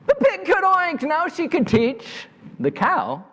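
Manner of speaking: high, delighted storyteller voice; excited announcement. A narrator's reading. Storyteller announces the pig could oink, excited and high-pitched. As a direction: proclaim the success high and excitedly. Story celebrates the pig's success.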